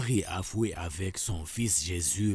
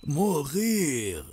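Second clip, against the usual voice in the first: normal talking voice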